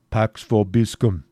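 a slow Latin blessing from a stranger in disguise; disguised friar's blessing. Acted. Cedric in disguise blessing a woman, slow and solemn. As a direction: say it slowly, playing the priest. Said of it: "said the pseudo-friar".

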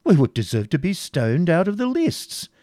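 horrified prior. A narrator's reading. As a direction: protest in pious horror, swooping upward. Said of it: "turning up his eyes in horror".

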